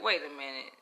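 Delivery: ordinary delivery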